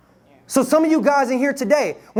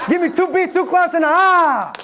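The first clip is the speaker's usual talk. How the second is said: high chanted call to the crowd; hype call; 5 semitones higher than usual. Shown as a demonstration. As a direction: lead the chant, pumping them up.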